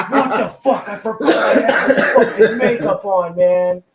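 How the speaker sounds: loud, high, laughing through the realisation; laughing quote. Retold or imitated. Sister realising in the store she still has Kiss makeup on, laughing. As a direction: crack up while playing her shock.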